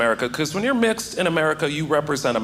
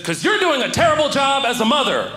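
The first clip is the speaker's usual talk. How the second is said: higher, sharp comeback; mock scolding; 9 semitones higher than usual. Comic answering the mother in the crowd, raised retort. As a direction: talk down to her. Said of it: "I said".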